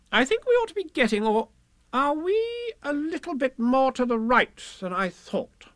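very high voice, quick then slowing; doubting Rabbit. A narrator's reading. Rabbit wondering about the way, high and uncertain. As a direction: reason aloud in Rabbit's high pitch.

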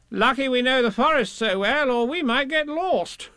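high, breezy character voice; falsely confident Rabbit. A narrator's reading. Rabbit lucky to know the forest, high and confident. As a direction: bluff along in Rabbit's high pitch.

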